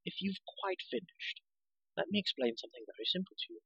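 soft, high, measured reply; patient condescension. Acted. Girl explaining to the ranting hero, softly patient.

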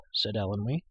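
plain reading voice

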